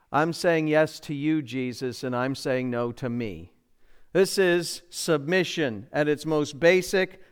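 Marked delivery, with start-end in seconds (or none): 0.1-3.5 soft sincere prayer. Acted. Voicing a believer's surrender prayer.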